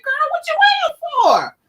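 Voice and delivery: put-on high, sassy "girl, what you waiting for"; egging her on with a high sassy call; playful high-pitched nudge to get moving; sassy prodding. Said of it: "I'm like".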